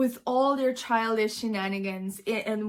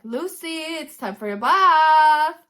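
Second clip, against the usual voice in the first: loud, higher call across the house; calling a child; 5 semitones higher, 9 dB louder than usual. Retold or imitated. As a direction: cut the drama short with a bath call. Caretaker cuts off the villain.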